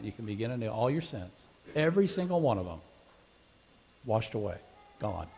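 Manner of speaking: preacher's hushed closing invitation; gentle invitation. Acted. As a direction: softly invite them forward to be baptized. Soft altar call invitation.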